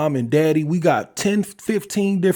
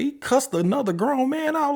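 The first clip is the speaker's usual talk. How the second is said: incredulous; 12 semitones higher than usual. Retold or imitated. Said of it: "I say".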